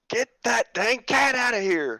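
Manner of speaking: mock yell, high and drawn out; slow, high-pitched holler imitating a fellow racer; imitated yell. Racer mimics Taylor yelling at the cat mid-race, imitated yell. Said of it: "you hear him yell".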